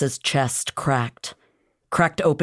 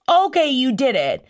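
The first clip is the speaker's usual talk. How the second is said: higher, grudging pat on the back; mock Illyrian approval; 9 semitones higher than usual. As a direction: play a smug warrior granting the win. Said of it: "would be like".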